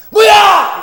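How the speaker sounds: sudden high, loud yell; angry yell. Retold or imitated. Husband yelling at his wife in the old days, furious shout. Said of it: "이렇게 소리를 질러가지고".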